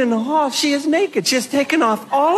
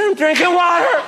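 shouted reply; 7 semitones higher than usual. Retold or imitated. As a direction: call out cheekily from the bathroom. Daughter shouting from bathroom.

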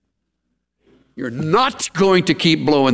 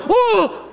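pained cry. Retold or imitated. Kid hit in the teeth.

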